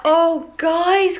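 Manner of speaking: bright high cheery hello; excited greeting. Real, not acted. Cheery morning greeting to viewers.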